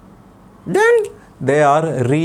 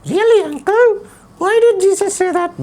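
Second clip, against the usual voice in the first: put-on puppet boy voice. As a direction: play the cheeky little boy puppet. Puppet child voice.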